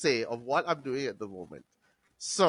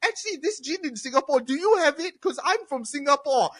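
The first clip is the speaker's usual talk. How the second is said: mocking Singaporean customer; 10 semitones higher than usual. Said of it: "Yeah, we fucking get it".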